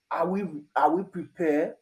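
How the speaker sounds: ordinary talk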